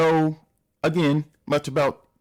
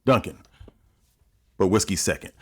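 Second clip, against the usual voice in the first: ordinary delivery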